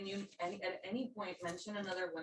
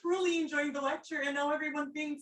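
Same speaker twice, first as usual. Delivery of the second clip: upset; 7 semitones higher, 10 dB louder than usual. Real, not acted. Student protests being screamed at.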